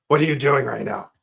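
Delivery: urgent, pushy salesman; too serious. Shown as a demonstration. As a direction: press them hard about insurance. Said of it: "come across like you're super serious".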